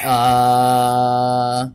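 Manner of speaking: आ pushed from the throat, loud and drawn. Shown as a demonstration. Teacher shows learners the throat sound, loud. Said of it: "इसको ऐसे निकालना है".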